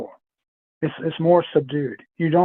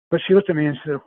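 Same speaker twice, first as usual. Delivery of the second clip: normal talking voice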